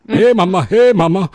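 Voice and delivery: calling out to the crowd, big and loud. Pretend. Host leading the chat call-out, loud and high. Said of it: "Somebody say".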